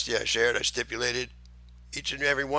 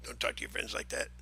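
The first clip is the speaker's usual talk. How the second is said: hushed aside; 13 dB quieter, faster than usual. Real, not acted. Aside in a low voice.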